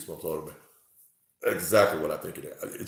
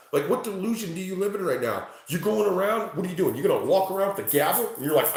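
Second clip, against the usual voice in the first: usual speaking voice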